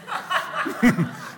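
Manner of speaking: short, high laugh. Real, not acted. Laughing after the pig story.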